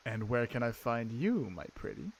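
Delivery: hushed, leering in-character flirt; creepy flirting. Acted. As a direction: drop low and linger suggestively. Flirtatious line to maid in character.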